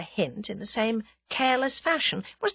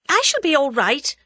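higher, louder brush-off; snapped; 7 semitones higher, 6 dB louder than usual. A narrator's reading. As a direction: refuse the pity sharply. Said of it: "snapped Tuppence".

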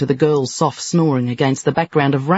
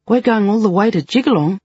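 higher, anxious disbelief; disbelieving question; 6 semitones higher than usual. Acted. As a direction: still can't believe it. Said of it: "asked Gracie once again".

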